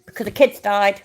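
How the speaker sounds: high-pitched sarcastic appeal; mock pleading. Streamer parodying Chili begging for money, mock pleading. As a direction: plead in a hammed-up charity-appeal voice. Mocking the host's plea for money.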